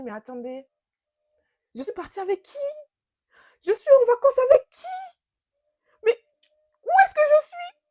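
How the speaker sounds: rising panicked cries of disbelief. Retold or imitated. To her friends, retelling the moment she panicked. Panicked realising she's on holiday with a stranger.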